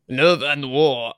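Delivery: slow, drawn-out mock French accent; failed French accent. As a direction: attempt a French accent, badly. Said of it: "I can't even do a French accent".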